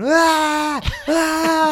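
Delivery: put-on high, loud wailing baby cry; shrieking toddler sob, hammed up; imitated toddler scream-cry. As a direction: wail like a scared little kid meeting a stranger. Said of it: "Just some little baby just scream".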